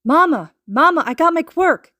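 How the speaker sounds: high, loud joyful call; crying out. Acted. Boy bursting in calling to his mother, loud and high. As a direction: shout the news through the door. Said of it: "crying out".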